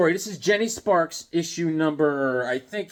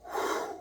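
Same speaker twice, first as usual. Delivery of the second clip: relieved exhale; 10 dB quieter than usual. Retold or imitated. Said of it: "I was like".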